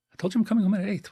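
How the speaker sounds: husband insisting to his wife he said eight; exasperated husband. Acted. Husband repeats, acted fight.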